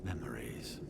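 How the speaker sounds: breathy whispered word, trailing off. Acted. Ally trailing off over lost sister's memories, whispering. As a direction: let the word fade into a hush.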